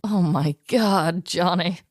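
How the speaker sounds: ordinary delivery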